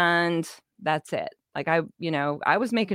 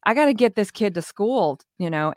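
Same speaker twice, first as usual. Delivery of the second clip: usual speaking voice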